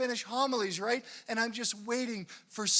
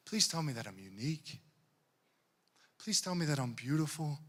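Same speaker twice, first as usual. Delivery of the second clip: pleading; 10 semitones lower, 6 dB quieter than usual. Retold or imitated. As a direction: beg softly to be loved. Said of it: "what are we saying?".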